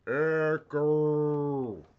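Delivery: loud called echo test; cave echo. Shown as a demonstration. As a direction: shout the word to test the echo. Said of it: "Oh, I can hear echo".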